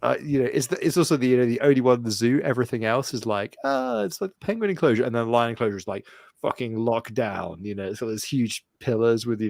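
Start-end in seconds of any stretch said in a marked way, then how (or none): 3.6-5.0 high mock breezy. Mocking other enclosures.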